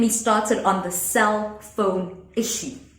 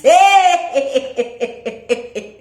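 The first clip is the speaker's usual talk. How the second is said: a big burst of real laughter; loud laugh; 3 semitones higher, 5 dB louder than usual. Real, not acted. Laughs at her own story.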